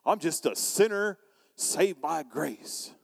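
slow, drawn-out self-deprecation; mock wretched confession, slowly stretched; feeling horribly bad. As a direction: drag it out like a sorry sinner. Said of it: "they feel so horribly bad about themselves".

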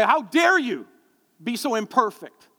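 high, loud hammed-up outrage, slowing at the end; mock indignation. Imagined son scolding his dad, hammed-up indignation. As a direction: play the offended son.

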